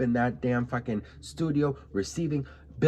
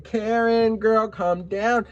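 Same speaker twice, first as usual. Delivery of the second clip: sassy retort; 7 semitones higher, 4 dB louder, much slower than usual. Retold or imitated. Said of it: "I said".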